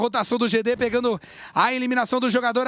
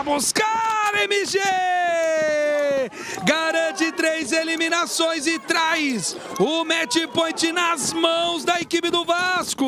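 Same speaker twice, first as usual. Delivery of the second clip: fighting game announcer; 7 semitones higher, slower than usual. Real, not acted. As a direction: hype shout, high and slow. Caster calls clutch round win.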